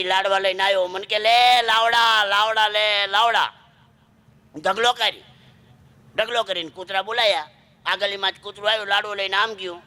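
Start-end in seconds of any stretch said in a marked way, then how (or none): 1.2-3.5 put-on slow, slightly raised drunken call; hint of a drunkard. Drunkard handing out laddus at night, drawn-out drunken call. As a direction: lightly play a drunk handing things out. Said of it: "રાત્રે દારૂડિયા દુકાન ખોલાઈ".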